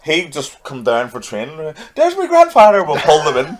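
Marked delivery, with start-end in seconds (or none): 1.9-2.8 higher, laughing mock greeting; mock excited greeting. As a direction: ham up an overeager fan calling out. Mocking fans greeting a relative.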